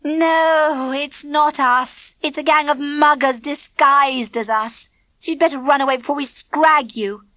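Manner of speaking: sarcastic. A narrator's reading. Clipper mocking unwanted Elaine.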